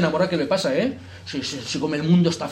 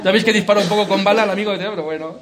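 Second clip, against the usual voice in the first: laughing; 4 semitones higher, 6 dB louder than usual. Real, not acted. Laughing at a joke about Miguel Ángel.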